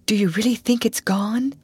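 faint, whispery question. A narrator's reading. Ruth nestling close to her sister, faint whisper. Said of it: "asked Ruth faintly".

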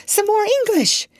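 high and eager; eager narrator's cry; excited. A narrator's reading. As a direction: rush the news out. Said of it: "I cried".

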